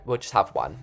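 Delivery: ordinary talk, nothing marked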